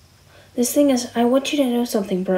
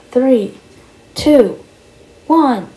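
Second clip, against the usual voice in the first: excited countdown; 6 semitones higher, 5 dB louder, much slower than usual. Acted. Countdown before jumping in water.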